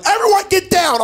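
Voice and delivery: high frantic mom shout; panicked mom. Retold or imitated. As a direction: yell it like a scared mother hiding everyone. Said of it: "she goes".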